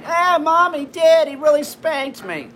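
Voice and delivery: mock whiny child insisting to his mom; whiny kid. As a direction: plead it like a kid covering for his dad. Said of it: "Like that".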